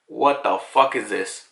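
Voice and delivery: low, slow mock disbelief; acted bafflement. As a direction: act dumbfounded, drawing it out. Voices Lucci's baffled reaction.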